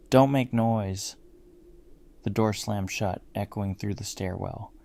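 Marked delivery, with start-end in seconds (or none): 0.1-1.2 slow, louder narrated shout. A narrator's reading. Old neighbor shouting at the narrator from her doorway, loud shout. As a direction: raise the voice on a drawn-out command. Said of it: "shouted loudly".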